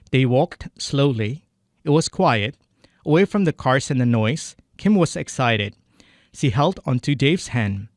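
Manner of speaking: careful English reading in an American-leaning accent; attempted model English accent. Shown as a demonstration. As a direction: read slowly, imitating a native English accent. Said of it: "saya akan coba ikutin". (logat Inggris tiruan, dibaca pelan dan jelas)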